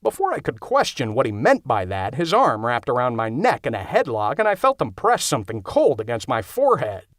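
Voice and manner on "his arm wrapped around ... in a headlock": ordinary delivery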